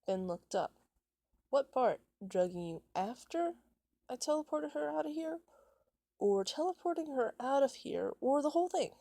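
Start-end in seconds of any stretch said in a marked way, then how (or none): 1.5-8.9 high, loud sarcastic questioning; mocking questions. Ben taunts angry Jeff about what upset him, mocking. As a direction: toss each option up louder and higher, taunting.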